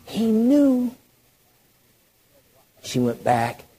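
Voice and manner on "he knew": high, sustained, drawn-out stress on knew; high, voiced emphasis. Real, not acted. Preacher repeating what Jesus knew, high emphatic tone. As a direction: lift the pitch and hold knew.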